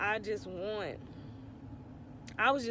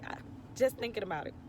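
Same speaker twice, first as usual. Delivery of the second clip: ordinary talk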